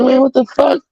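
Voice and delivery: high, startled outburst; just jolted awake and confused. Retold or imitated. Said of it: "I'm like".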